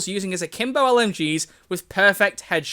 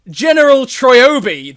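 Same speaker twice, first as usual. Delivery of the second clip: loud, high, drawn-out reply; mock movie quote; 5 semitones higher, 11 dB louder, slower than usual. Goat answering Troy's hello there, grand parody. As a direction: stretch it out grandly and loudly. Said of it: "I need to do it in a certain tone of voice".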